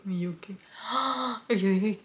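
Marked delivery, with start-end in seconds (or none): 0.0-0.5 shocked whisper. Retold or imitated. Retelling shock at fiancé's news. 0.8-1.4 shocked gasp. Retold or imitated. Retelling shock at fiancé's news.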